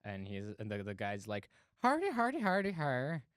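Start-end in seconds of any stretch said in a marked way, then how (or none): 1.8-3.2 put-on jokey Irish accent as the goat's owner; mock Irish accent. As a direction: bark it in a hammed-up Irish accent. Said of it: "Hope you enjoy our Irish accents".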